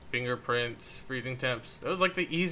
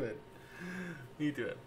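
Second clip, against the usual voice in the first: stretched, slowed, soft words to a dog; drawn-out doggy talk to her pet; slow cooing pet talk; 12 dB quieter, much slower than usual. Real, not acted. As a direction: coo it slowly like to a puppy.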